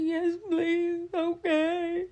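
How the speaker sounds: high, loud mock wail; desperate pleading. Patient begs staff to stay in the room, desperate wail. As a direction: beg in a comic tearful squeal.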